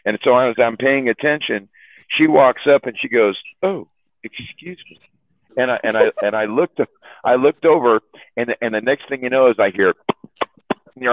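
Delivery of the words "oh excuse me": high, hushed, polite excuse me; timid interruption. Retold or imitated. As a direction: imitate an embarrassed lady softly butting in. Lady interrupts couple in park.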